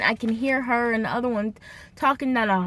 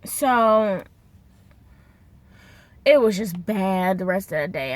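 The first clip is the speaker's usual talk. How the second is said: ordinary delivery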